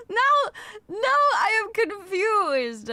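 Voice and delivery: high, drawn-out whine; slow, exaggerated wail of bafflement; confused wail. Real, not acted. Streamer confused by the mic levels, high slow wail.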